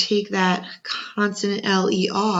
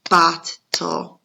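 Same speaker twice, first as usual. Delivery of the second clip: louder, "bottle" struck as bot and tle; pounding syllables; 7 dB louder than usual. Shown as a demonstration. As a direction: hit each part of "bottle" like a drum. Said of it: "Let's pound the word bottle".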